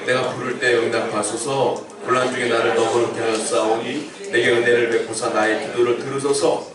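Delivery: measured, reverent recitation; scripture reading. Acted. Reads the verse aloud over music.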